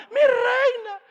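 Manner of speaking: very high, loud crying wail; hammed-up shouted sob; mock wailing. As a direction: wail for mercy. Haman wails as king enters.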